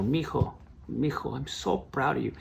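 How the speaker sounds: softly voiced mother with a Spanish accent; mother's Spanish accent. Tearful mother to her award-winning son, in a Spanish accent. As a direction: put a light Spanish accent on the proud mother, soft. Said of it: "She really didn't speak with an accent, but I'm adding that just for dramatic effect".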